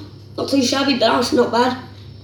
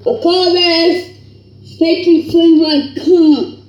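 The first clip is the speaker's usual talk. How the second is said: loud, higher, laughing cheer; triumphant shout; 5 semitones higher, 6 dB louder than usual. Real, not acted. Youtuber celebrating a winning pack, shouting with laughter. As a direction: crow over the win.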